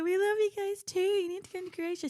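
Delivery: high, eager gushing; playful over-the-top reply; mock gushing reply. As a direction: gush right back at them. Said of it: "And then we're like".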